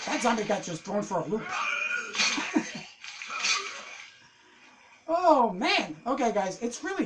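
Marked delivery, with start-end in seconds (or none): 1.5-4.0 breathy silent laugh. Real, not acted. Zombie thrown for a loop.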